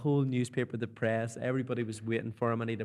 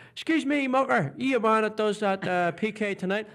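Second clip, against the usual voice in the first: higher, broad Belfast punter voice; chip shop customer; 8 semitones higher, 4 dB louder than usual. Retold or imitated. Belfast chip shop customer recognising the presenter, broad accent. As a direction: do the cheeky Belfast local. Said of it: "this guy said to me".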